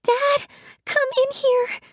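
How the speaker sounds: high panicked cry for Dad; frightened call. Acted. Piper calling Dad into her room, high panicked cry. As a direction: call for help, scared. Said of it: "I screamed".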